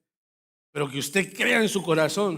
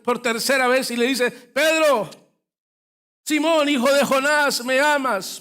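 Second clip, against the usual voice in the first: louder, higher preaching climax; preaching at full force; 9 semitones higher, 8 dB louder than usual. Acted. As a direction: build the story to a fervent peak. Climax of the sermon story.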